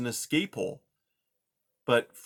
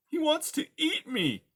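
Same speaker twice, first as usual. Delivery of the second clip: faint mouse voice. Acted. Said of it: "Poppy said faintly".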